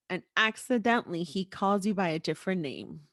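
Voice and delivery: ordinary talk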